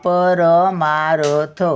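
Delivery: परमार्थ spoken very slowly, र barely touched; slow demonstration. Shown as a demonstration. Teacher repeating the word to the pupils, very slowly. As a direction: stretch परमार्थ for the class. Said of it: "आता बघा".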